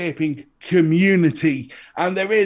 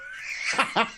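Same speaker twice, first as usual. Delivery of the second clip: high squeal laugh; 6 dB quieter than usual. Real, not acted. Reacting to syphilis joke.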